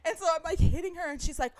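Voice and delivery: ordinary delivery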